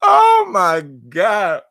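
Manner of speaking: high, slow, wheezing oh my God; drawn out through laughter; laughing disbelief. Real, not acted. Vlogger wheezing at the bizarre footage, laughing.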